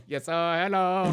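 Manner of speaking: light mock Indian accent hello; imitating an Indian accent. Host echoing the caller's Indian accent hello. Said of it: "Is that your go-to to get the Indian accent on?".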